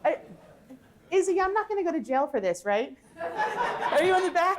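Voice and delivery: high, loud, laughing worry about jail; laughing nervous joke. Real, not acted. Laughing, asking the producer if this is safe.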